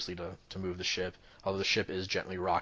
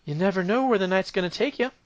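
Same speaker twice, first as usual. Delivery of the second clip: high, put-on sultry accent; lady with hands on hips, flirting; seductive dockside lady; 11 semitones higher, 8 dB louder than usual. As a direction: tease with a knowing purr.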